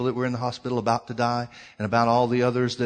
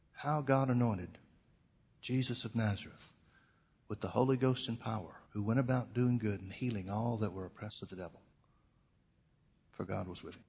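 gentle inner voice of the Holy Ghost; small quiet voice; 7 dB quieter than usual. Retold or imitated. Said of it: "quiet voice that started coming up".

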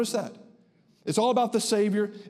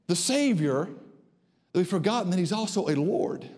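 ordinary talk, nothing marked

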